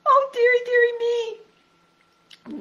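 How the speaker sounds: high, loud tearful whimper; a tiny pig near tears; whimpering Piglet. A narrator's reading.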